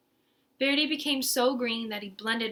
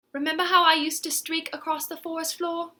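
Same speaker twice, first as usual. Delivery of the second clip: Ribbon's voice; 6 semitones higher than usual. Acted. Ribbon reminisces.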